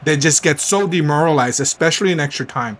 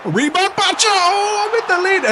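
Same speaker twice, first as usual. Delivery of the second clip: real elation as the goal goes in; ecstatic yell. Real, not acted. Gamer yelling as his striker scores the lead. Scoring late go-ahead goal.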